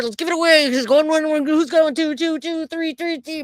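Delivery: fast rising auctioneer patter. Pretend. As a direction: rattle off bids like an auctioneer. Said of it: "so like an auctioneer".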